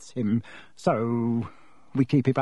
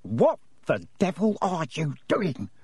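slow, seething uncle; tightly held-in fury, slowly; through gritted teeth; 3 semitones higher than usual. Acted. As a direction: force each word out through clenched teeth. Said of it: "said Uncle Vernon through gritted teeth".

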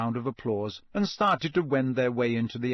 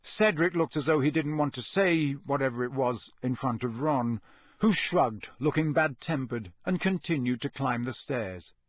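usual speaking voice

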